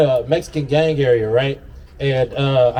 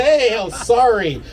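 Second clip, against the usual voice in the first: high, drawn-out placating apology; acting out a nervous backing-down; retold apology; 6 semitones higher, slower than usual. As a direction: stretch out a pleading sorry. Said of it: "I never apologized so fast".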